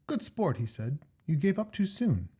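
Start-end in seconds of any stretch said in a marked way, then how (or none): 0.1-0.6 higher, eager Jack; Jack's voice. Acted. Jack's voice in story. 1.2-2.3 higher, eager Jack; Jack's voice. Acted. Jack's voice in story.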